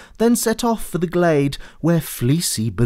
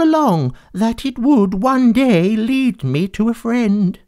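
a lonely villain opening up; softened cat; 8 semitones higher than usual. Acted. Fleecy confesses loneliness to George, high and gentle.

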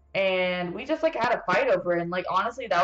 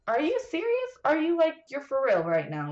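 incredulous; 7 semitones higher than usual. Real, not acted. Ranting about boyfriend's choice.

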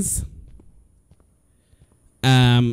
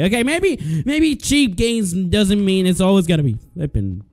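ordinary talk, nothing marked

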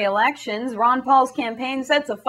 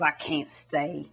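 slow, much softer mock grumble; mock Dick scowling; 9 semitones lower, 8 dB quieter, slower than usual. Bush retells Dick scowling at him, slow and soft. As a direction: drop low and slow, a quiet gruff aside. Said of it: "He says".

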